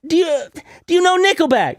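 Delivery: high, strained and halting; imitated oddball question. Woman asking him about Nickelback, high mock voice. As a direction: quiz him in her high voice.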